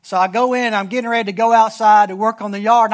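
normal talking voice

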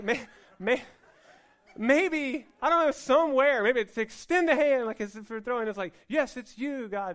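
high and loud, laughing as she muses; excited musing. Retold or imitated. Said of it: "And I'm like".

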